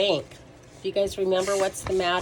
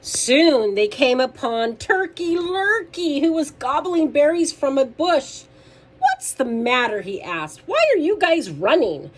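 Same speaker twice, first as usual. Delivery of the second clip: loud, lifted storytime voice; lively read-aloud for kids; animated children's story reading; 5 semitones higher, 6 dB louder than usual. A narrator's reading. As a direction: read it out big for little listeners.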